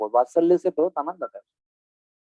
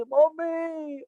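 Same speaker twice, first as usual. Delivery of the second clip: put-on crying child; 10 semitones higher, much slower than usual. Child Krishna wailing to mother.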